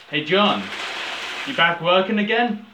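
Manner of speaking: loud called greeting; mock shout to John; calling out. Retelling a film scene.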